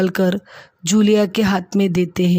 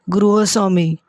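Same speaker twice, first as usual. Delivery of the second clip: ordinary delivery, nothing marked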